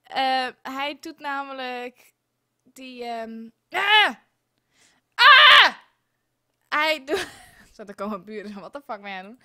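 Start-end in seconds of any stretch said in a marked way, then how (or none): 5.1-5.8 a real loud scream of frustration; frustrated scream. Real, not acted. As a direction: let out a full angry yell at the game. Game keeps crashing, frustrated.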